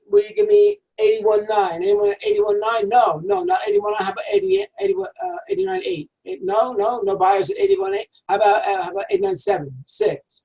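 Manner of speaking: fast, high, rolling auctioneer patter. Pretend. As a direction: call out bids like an auctioneer. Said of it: "picture a typical auction house".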